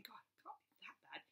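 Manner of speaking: whispered scoffing thought. Retold or imitated. Viewer whispers a scoffing thought while watching One Born. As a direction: whisper it, dismissive.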